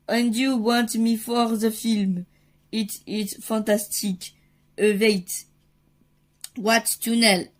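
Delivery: put-on French-accented go at American English; attempted American accent. Actor dad offered a film by phone, attempted American accent. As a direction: struggle through in would-be American English. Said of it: "mon accent américain s'est vraiment bien amélioré".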